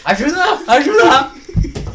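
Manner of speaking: high, loud laughing call for help; mock cry for help. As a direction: yell for help in jest.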